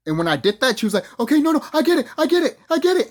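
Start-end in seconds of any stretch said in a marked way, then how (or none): 1.2-3.1 very high, loud imitated begging; mock tearful pleading. Executive begging the intruder to stop, mock tearful pleading. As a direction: imitate her begging frantically, pitched way up. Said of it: "she was literally crying".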